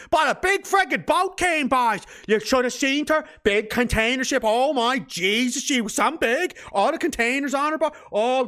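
hammed-up Newfoundland local, all wonder; Newfoundland accent. Locals gawking at a big container ship, hammed-up Newfoundland accent. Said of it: "that's mostly a Newfoundland accent".

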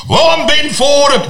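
puffed-up mock radio host introducing himself; Ben Fordham impression. Mocking a rival radio host's pompous manner, high pompous voice.

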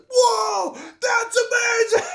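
very high, slow awed shout; amazed kid. Retold or imitated. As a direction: gasp it like a wowed child. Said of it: "as a kid you're like".

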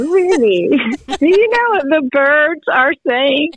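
put-on high, slow, sweet coaxing to a toddler; coaxing grandma voice. Grandmother asking granddaughter what birds say, high coaxing. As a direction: draw the little one in with a playful secret.

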